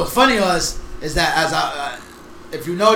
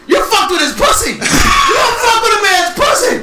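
hammed-up outraged shout, higher and louder; mock outraged yell; 12 semitones higher, 4 dB louder than usual. Friends recapping a wrestling show, acting out a mock outraged yell. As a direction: yell it like a fan losing it. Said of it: "I was like".

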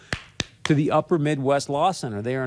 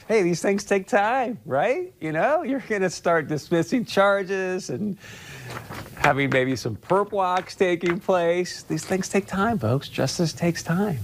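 higher, slow mock-knowing repeat; mock echo; 6 semitones higher, slower than usual. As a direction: savor the line as if in on the joke. Mocking Barr's smug line.

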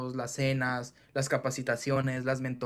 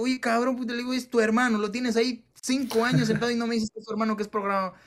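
higher, louder mock outrage; man scolding his partner over a hidden brother, high and loud; exasperated call-out; 8 semitones higher, 4 dB louder than usual.

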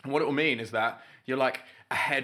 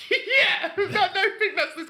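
laughing; 6 dB louder, slower than usual. Real, not acted. Laughs at golf caddy joke.

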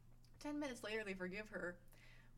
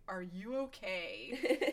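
slow, louder, laughing mock concern; 9 dB louder, much slower than usual. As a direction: drag out a stunned are you okay. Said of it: "And Nate is like".

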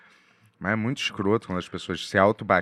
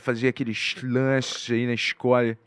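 hammed-up Rio accent, pitched up; exaggerated Rio de Janeiro accent; heavy Carioca accent; 4 semitones higher, 3 dB louder than usual. Shown as a demonstration. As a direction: put on a thick Carioca accent. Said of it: "Sotaque carregado é aquele".

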